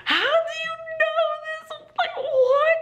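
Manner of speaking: very high-pitched, squealed disbelief; shocked. Retold or imitated. Teacher to student who just swore, shocked. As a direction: squeal the question in astonishment. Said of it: "I was so shocked".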